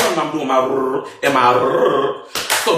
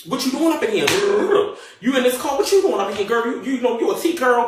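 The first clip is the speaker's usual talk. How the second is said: nosy officer; 7 semitones higher than usual. Retold or imitated. Voicing police woman.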